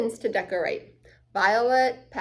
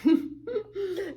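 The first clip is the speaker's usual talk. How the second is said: high laugh; 9 semitones higher than usual. Real, not acted. Child reacting to story.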